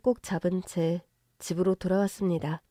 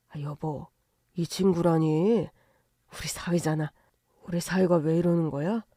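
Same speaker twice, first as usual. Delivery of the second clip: gently baffled, lower-pitched husband; puzzled husband; 3 semitones lower than usual. Acted. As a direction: ask your wife in mild bewilderment. Narrator voices the puzzled husband.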